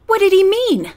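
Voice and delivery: 高く大きく叫ぶ問い; shouted. A narrator's reading. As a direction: demand it in a sudden loud shout. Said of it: "Jacob Sidney shouted".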